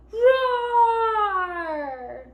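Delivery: loud, high, long drawn-out "roar"; big lion roar. A narrator's reading. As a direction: let a huge roar out and trail it down. Lion lets out his roar.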